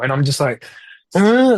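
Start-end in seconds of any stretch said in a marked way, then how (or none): 1.1-1.6 very high, louder huh of disbelief; blindsided huh. Retold or imitated. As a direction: hit with the sudden sale of his player. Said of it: "Like, blindsided".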